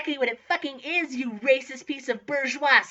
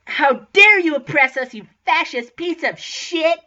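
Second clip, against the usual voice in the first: indignant, much louder shout; epic yell; 7 dB louder than usual. Acted. Student protesting to the headmaster, epic yell. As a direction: yell it as a drawn-out, outraged accusation. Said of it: "I yelled epically".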